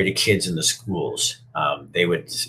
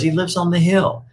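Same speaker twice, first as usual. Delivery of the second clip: higher, simple and kind; higher girl puppet voice; 11 semitones higher than usual. Retold or imitated. Lucinda tells Punchinella where Eli lives, higher. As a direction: tell him plainly in a higher puppet voice.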